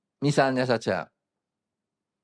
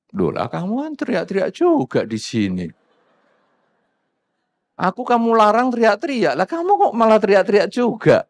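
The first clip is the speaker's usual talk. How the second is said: acted protest building louder and higher; indignant retort; 4 semitones higher, 3 dB louder than usual. Noisy latecomer answering the person who scolded him, indignant. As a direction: throw the accusation back, rising in volume.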